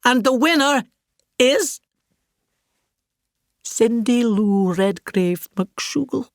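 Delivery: drawn-out announcer; award announcer. Retold or imitated. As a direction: build the suspense to the name. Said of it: "uttered the worst words".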